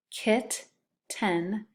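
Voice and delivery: hap-py split into two beats, each syllable tapped. Shown as a demonstration. Teacher to second graders, splitting hap-py into syllables. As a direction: tap out hap-py slowly, one syllable at a time. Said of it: "The next one is hap-py".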